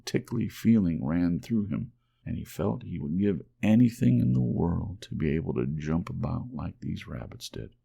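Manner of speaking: ordinary delivery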